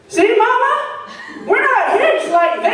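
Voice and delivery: put-on high, loud little-girl outside voice; a toddler announcing to the whole store; loud four-year-old. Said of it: "in her outside four-year-old voice".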